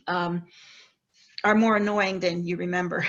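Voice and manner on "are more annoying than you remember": usual speaking voice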